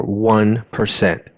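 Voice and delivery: usual speaking voice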